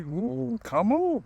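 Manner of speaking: high, drawn-out eager ooh. Retold or imitated. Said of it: "I was like".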